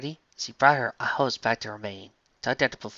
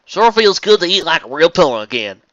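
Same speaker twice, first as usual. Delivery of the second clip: louder, higher southern voice; Applejack content; 6 semitones higher, 12 dB louder than usual. Acted. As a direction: content farm pony.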